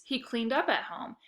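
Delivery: ordinary delivery, nothing marked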